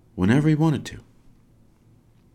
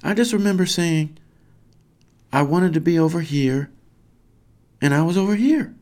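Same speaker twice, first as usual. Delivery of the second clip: put-on high, raised boyish call; yelling back; 11 semitones higher, 4 dB louder than usual. Boy explains from the island, yelling back. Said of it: "Kraft yells back".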